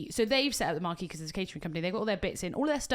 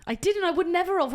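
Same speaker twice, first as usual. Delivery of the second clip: indignant denial; 8 semitones higher, 5 dB louder than usual. Real, not acted. Denies the accusation.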